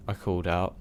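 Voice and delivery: plain reading voice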